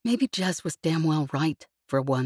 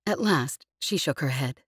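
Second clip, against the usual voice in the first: ordinary delivery, nothing marked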